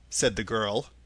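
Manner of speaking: plain reading voice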